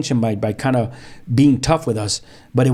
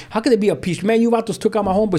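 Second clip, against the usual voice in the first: high-pitched incredulous protest; retold indignant objection; 9 semitones higher, faster than usual. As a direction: argue back fast, retelling a gang dispute.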